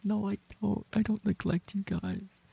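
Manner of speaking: high, protesting; squeaky denial. Real, not acted. Streamer squeaks a protest at chat.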